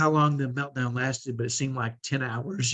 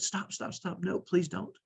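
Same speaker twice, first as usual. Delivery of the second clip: urgent hushed plea; 6 semitones higher, 8 dB quieter, slower than usual. Retold or imitated. Begging cashier not to intervene.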